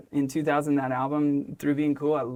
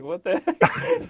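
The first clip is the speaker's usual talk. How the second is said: slow, high, laughing disbelief; baffled; 11 semitones higher, much slower than usual. Retold or imitated. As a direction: drag it out, amused and stunned. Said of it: "and Tom's like".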